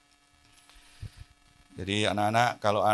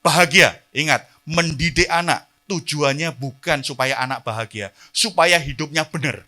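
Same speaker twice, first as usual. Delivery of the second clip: loud, high, driving sermon voice; fiery preaching; 12 semitones higher, 16 dB louder than usual. Acted. Pastor exhorting parents from the pulpit, loud fiery preaching. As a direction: preach it hard to the congregation.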